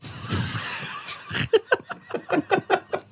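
soft, voiceless wheeze of laughter; real wheezing laugh. Real, not acted. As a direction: laugh almost without voice. Laughs at the cancer joke.

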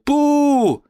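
one loud mock boo from the crowd; booing shout. Shown as a demonstration. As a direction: boo the comedian like a heckler. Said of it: "der havde jeg lyst til at bare råbe".

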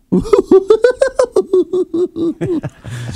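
high manic Joker cackle; Joker laugh. Shown as a demonstration. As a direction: cackle like a madman. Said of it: "they also say the Joker".

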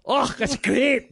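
high, loud, slow Scottish burr; hammed-up Scottish accent. Shown as a demonstration. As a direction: put on a big stagey Scottish accent. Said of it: "I can do a Scottish accent".

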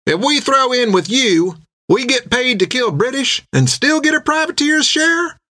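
a sailor calling out his question from the back; mild mock shout across a tavern. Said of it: "shouted a man from the far side".

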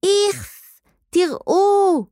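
put-on high-pitched girl's screech. A narrator's reading. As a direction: squeal it out in disgust. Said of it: "צרחה נוצי". (צווחת גועל של ילדה)

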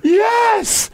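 very high mock wail; over-dramatic woman. Imitating the woman's answer, very high wail. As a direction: squeak it out dramatically. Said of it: "are they in the car?".

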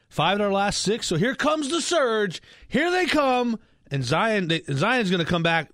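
oversell it as fake excitement; dripping sarcasm. Co-host hyping the Pelicans' surge to Jake, sarcastically. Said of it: "amount of sarcasm that's dripping".

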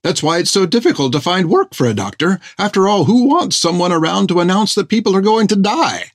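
a prim, fussy gentleman; fussy doctor voice. Acted. Doctor Thiggum's voice in story.

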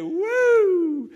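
high, loud "woo"; celebratory whoop. Acted. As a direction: whoop like an overjoyed crowd. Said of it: "I can imagine they".

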